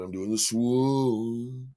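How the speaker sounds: long, sliding swoon; drawn-out relish. Retold or imitated. Storyteller showing off his dance moves, drawn-out relish. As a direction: stretch SWOON out long and slow.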